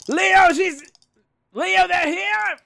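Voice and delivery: high, loud, drawn-out yell; yelled warning. Pretend. Player yelling a warning to Leo as he rolls, high drawn-out yell. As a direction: yell his name in alarm, high and long. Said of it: "Can I yell his name while I do this?".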